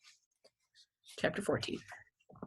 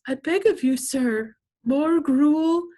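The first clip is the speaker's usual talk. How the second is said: higher, hammed-up pleading beggar voice; begging plea; 7 semitones higher, 4 dB louder, much slower than usual. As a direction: beg for more food like a theatrical orphan, pitched up. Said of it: "And he always goes".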